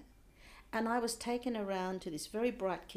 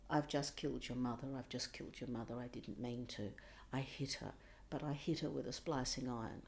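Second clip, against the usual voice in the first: retold sobbing confession; 7 semitones lower, 6 dB quieter, faster than usual. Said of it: "And he was sobbing".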